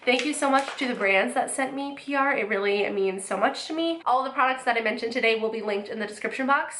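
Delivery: ordinary delivery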